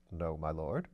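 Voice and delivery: a composed valet answering his master; Bunter the valet. Acted. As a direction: impassive butler, low and quiet. Narrator voices Bunter the valet.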